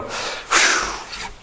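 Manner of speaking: long airy exhale of relief; soft, voiceless whoosh of breath; relieved phew. Acted. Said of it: "did you go".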